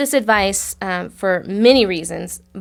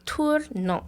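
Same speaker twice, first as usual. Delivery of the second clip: tournant in a put-on French accent, higher; 6 semitones higher, 6 dB quieter than usual. Shown as a demonstration. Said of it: "Y'all like my French accent?".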